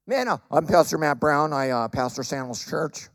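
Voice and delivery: low, hesitant self-parody; mock dull introduction. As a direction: stumble through it low and underwhelmed. Mocks his own dull introduction.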